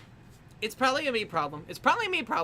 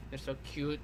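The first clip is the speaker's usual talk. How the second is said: hushed, softer fond "They're so cute."; soft fond murmur over a drawing; soft cooing; 7 semitones lower, 9 dB quieter than usual. Real, not acted. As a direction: coo over the picture softly.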